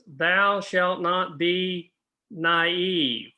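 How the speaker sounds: mock-biblical commandment, each word drawn out; slow, weighty "Thou shalt not be naive"; slow solemn pronouncement. Shown as a demonstration. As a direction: read it slowly like a commandment carved in stone. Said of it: "I'm gonna flash this up here".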